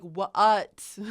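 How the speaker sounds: loud "What" split as wa-ut; wrong W with schwa. Shown as a demonstration. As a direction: overdo "What" with an added uh. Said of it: "We don't say it that way".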